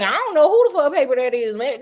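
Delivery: fast, loud, high brash dismissal; tough girl voice. Pretend. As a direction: play it off with attitude. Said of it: "and be like".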